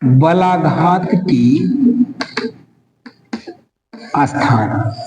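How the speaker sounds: very slow and low, long gap between words; dictation. Shown as a demonstration. Teacher giving students the next heading, slow dictation. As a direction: spell out the heading at writing speed.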